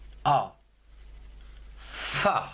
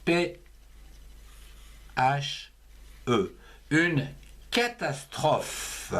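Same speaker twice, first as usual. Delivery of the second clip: slow dictation; 2 semitones higher, slower than usual. Shown as a demonstration. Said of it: "il reste plus qu'à rajouter feu".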